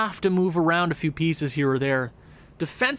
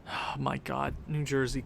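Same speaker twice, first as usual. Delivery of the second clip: soft groan; 6 semitones lower, 10 dB quieter than usual. Real, not acted.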